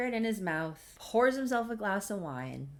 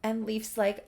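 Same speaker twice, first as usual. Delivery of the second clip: usual speaking voice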